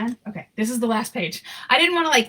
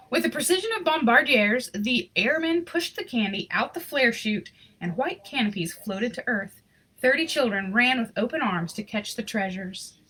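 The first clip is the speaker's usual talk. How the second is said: lively storybook reading voice; story narration; 4 semitones higher than usual. A narrator's reading. Reading a Christmas picture book aloud, storyteller voice. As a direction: read the story warmly to an audience.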